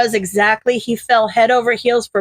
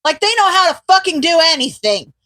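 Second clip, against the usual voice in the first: furious ranting shout; yelling; 5 semitones higher than usual. Acted. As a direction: yell it out in outrage. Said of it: "Midoriya yells".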